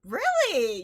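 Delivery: loud, high-pitched surprise; surprised. Real, not acted. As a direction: blurt it out in loud disbelief. Surprised at no-ketchup answer.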